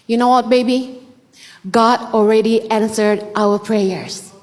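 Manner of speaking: slow, soft telling of good news; tender to children. Retold or imitated. Mother telling her children the prayer was answered, slow and tender. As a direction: gently reassure your kids.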